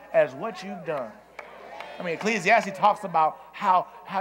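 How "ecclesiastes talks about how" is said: usual speaking voice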